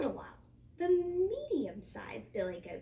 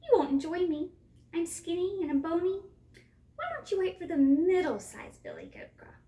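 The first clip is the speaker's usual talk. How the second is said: high, raised little goat voice; pleading little goat; 6 semitones higher, 7 dB louder than usual. A narrator's reading. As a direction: bargain anxiously, pitched up high. Littlest goat bargains with troll.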